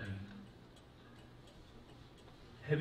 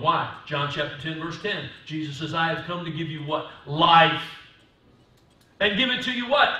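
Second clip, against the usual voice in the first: rising sermon peak; preaching at full force; 7 semitones higher, 12 dB louder than usual. Acted. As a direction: lift the whole church with it. Preaching climax, loud and high.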